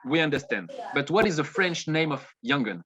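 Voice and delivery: normal talking voice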